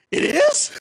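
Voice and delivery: very high, loud squeal of disbelief; astonished. Retold or imitated. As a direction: shriek it as a stunned question. Astonished to hear of healing.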